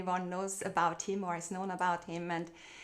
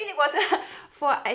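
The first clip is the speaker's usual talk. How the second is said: laughing through the words; giddy laugh while recalling the dream job; 7 semitones higher, 8 dB louder, much faster than usual. Real, not acted. Guest telling host about flying, laughing happily.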